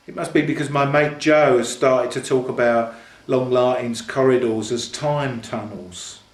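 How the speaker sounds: ordinary delivery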